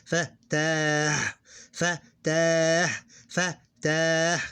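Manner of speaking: "fa, ta" with a long "ta" trailing into a breathy h; pausal ha ending. Shown as a demonstration. Teacher repeating the form when you ignore the vowel, pausal ending. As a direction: repeat "fa, ta", stretching "ta" and dropping the final vowel. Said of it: "you ignore this, and it's ha".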